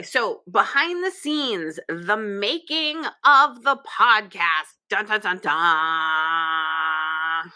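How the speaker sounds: hammed-up teaser build-up with dun dun dun; mock dramatic trailer. Mock trailer intro for the podcast.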